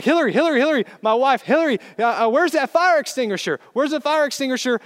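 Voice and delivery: high, loud frantic calling for his wife; retold screaming. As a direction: act out yelling for help in a panic. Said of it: "I'm screaming at the top of my lungs".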